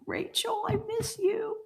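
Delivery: high, soft whispered longing. Real, not acted. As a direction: breathe it like a wistful secret. Missing her absent friend.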